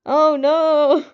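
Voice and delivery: loud, high, hammed-up wail; exaggerated wail. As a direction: fake a clumsy fall, way over the top. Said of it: "you wailed out".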